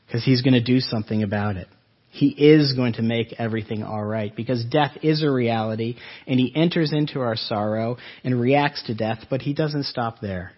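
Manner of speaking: normal talking voice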